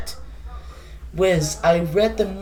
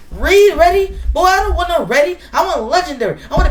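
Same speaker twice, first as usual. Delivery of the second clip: outraged; 6 semitones higher, faster than usual. Acted. Outraged retort.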